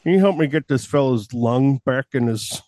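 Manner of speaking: Buffalo Bill. Pretend. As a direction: menace the listener like a horror film villain. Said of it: "I'm Buffalo Bill".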